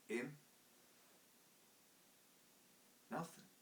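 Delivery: quiet Cockney reply. Acted.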